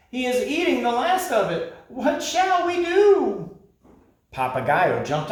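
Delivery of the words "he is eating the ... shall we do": panicked wail. Acted. Creatures wailing as the dog eats the moon, very high.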